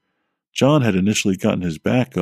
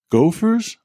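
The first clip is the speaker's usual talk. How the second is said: innocent, sharply rising question; naive question; 10 semitones higher than usual. A narrator's reading. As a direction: ask it wide-eyed and innocent, pitched way up. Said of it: "I naively asked".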